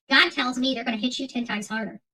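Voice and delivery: ordinary talk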